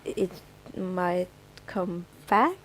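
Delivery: ordinary talk, nothing marked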